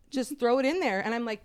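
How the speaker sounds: ordinary talk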